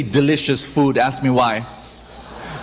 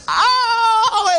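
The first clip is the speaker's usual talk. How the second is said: hammed-up ow. Trespasser trips and falls.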